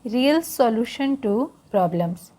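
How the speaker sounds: Real Solution to Problems read word by word, very slowly; slow title read aloud to the class; slow careful reading. Shown as a demonstration. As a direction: spell out the title slowly for learners. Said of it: "उसका title है".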